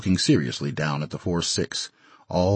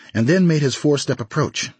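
ordinary delivery

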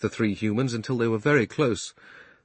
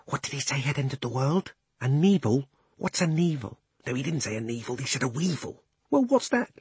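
higher, quieter puzzled animal voices; confused animals; 4 semitones higher, 3 dB quieter than usual. Acted. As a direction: muddle it like chattering beasts.

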